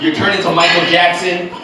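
ordinary delivery

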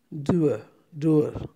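ordinary talk

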